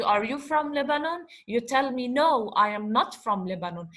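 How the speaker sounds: ordinary talk, nothing marked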